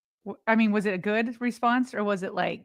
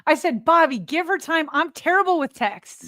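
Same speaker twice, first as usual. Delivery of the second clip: exasperated, raised voice; emphatic I-told-you-so; 5 semitones higher, 6 dB louder than usual. Real, not acted. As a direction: protest it, pitched well up.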